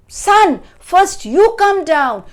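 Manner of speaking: high, loud motherly order; calling up urgently. A narrator's reading. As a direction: shout up to a son in danger. Mother shouts up to Jack.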